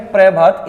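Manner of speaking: "प्रभात" with a half p run into r; "प्रभात" opening on a fused pr; joined "pra" pronunciation. Shown as a demonstration. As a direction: say "प्रभात" as "pre-bhaat". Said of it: "इस बात का मतलब है आधा पे".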